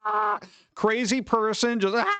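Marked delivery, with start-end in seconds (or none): 0.0-0.4 long, high-pitched mock scream; imitated crazed scream. Crazy person on the subway car, imitated screaming. 2.0-2.1 short high mock shriek; imitated crazed scream. Crazy person on the subway car, imitated screaming.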